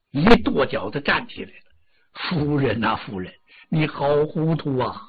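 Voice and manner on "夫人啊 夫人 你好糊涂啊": softer, teary lament to his wife; choked-up tearful reproach. Acted. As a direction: hold back tears as you scold her gently. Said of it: "他也眼泪汪汪".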